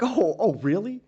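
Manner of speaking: high, mock-impressed "really"; sarcastic disbelief. Laughing at people who call themselves alpha, sarcastic. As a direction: pretend to be wowed, dripping sarcasm. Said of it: "I'm like".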